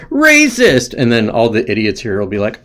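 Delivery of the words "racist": high-pitched parroted accusation; mock accuser. Parroting the easy comeback, mock accusing. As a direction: cry it out high, as a mocking catchphrase. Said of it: "all you have to do is say".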